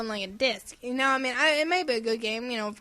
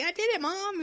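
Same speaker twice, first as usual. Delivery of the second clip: higher, proud little kid imitation; mock happy; 8 semitones higher than usual. Podcaster imitating the cute creature after eating, happily. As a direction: chirp it like a child showing Mom. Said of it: "he gets all happy".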